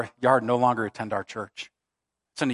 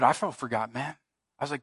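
ordinary talk, nothing marked

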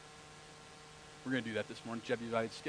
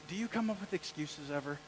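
ordinary talk, nothing marked

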